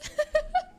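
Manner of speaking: squeaky laugh bursting out; high burst of laughter. Real, not acted. Teller cracks up retelling the dealer's comeback, high laugh. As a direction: crack up at your own story.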